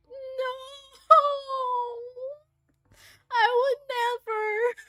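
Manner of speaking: high, drawn-out cooing denial; tender protest. Retold or imitated. Acted tender protest to sick Ren.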